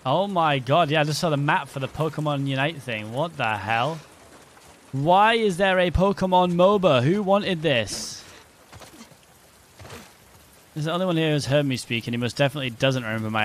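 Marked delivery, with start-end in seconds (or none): 4.9-8.2 drawn-out, exasperated disbelief; incredulous outcry. Real, not acted. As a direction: stretch the question out in real disbelief. Disbelief at a game announcement.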